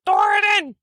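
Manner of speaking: high-pitched call to a companion; in-character yell. Acted. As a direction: yell it high, as if across a room. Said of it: "And I'm gonna yell".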